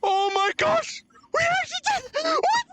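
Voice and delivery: high, breathless elation; overjoyed disbelief. Real, not acted. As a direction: gasp it out, overwhelmed. Overwhelmed after beating boss.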